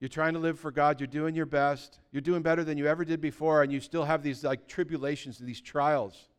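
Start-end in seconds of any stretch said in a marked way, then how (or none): none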